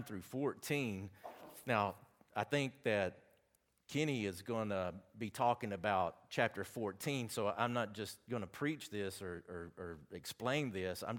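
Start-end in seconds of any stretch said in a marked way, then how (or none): none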